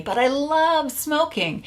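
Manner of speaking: high, slow mock pleading; smoker brain's protest. The smoker part of the brain insisting, mock pleading. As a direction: act out a craving voice protesting. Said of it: "there's a part of our brain that will always".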